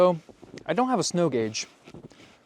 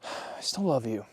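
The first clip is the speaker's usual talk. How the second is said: softened reassurance; 6 semitones lower, 7 dB quieter than usual. Real, not acted. Calming after loud rant.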